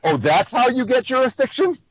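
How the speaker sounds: mock a statement passed off as a question, lifting "jurisdiction"; questioning uptone. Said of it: "That's not a question".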